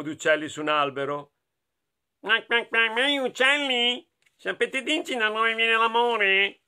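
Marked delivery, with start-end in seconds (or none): 2.2-6.6 raised, cartoon duck voice; quacking duck. Duck asking the birds about love, quacky high voice. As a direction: quack the words out, pitched high.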